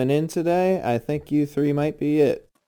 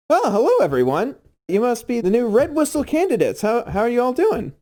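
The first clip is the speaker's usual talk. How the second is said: put-on cheerful young man; 9 semitones higher than usual. GM voices young instructor.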